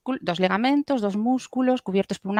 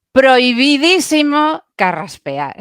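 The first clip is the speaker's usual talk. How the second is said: slow, loud and higher warning; emphatic warning; 6 semitones higher, 5 dB louder, slower than usual. Real, not acted. Voice coach forbidding throat clearing, emphatic warning. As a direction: lay down the rule firmly. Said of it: "strong warning".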